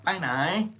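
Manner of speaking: Pai nai with the correct rising inflection; proper Thai tone. Shown as a demonstration. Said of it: "It's like".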